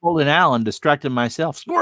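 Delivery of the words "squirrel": high yelp of Squirrel!; teasing cohost with a high yelp; mock squeal. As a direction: squeak it like a distracted dog. Joke about getting distracted.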